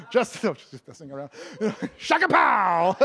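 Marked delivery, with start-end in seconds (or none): 2.0-3.0 drawn-out, loud mock battle cry; playful exclamation. Preacher breaking up his own joke, playful shout. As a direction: belt out a silly catchphrase, stretched long.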